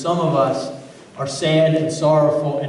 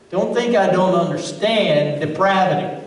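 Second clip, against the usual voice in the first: normal talking voice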